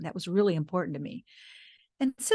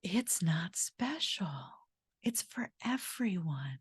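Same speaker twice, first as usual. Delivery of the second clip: whispered; 7 dB quieter, slower than usual. Acted. Repeating the teacher's message.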